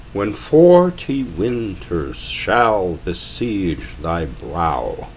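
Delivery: very slow, every iamb marked evenly; overly strict time. Shown as a demonstration. Lecturer reading a Shakespeare line in exaggeratedly strict meter. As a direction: beat out each foot like a metronome. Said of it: "I'm going to read this in more strict time than should be done".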